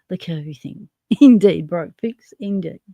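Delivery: usual speaking voice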